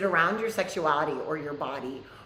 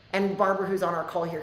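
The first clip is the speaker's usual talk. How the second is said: usual speaking voice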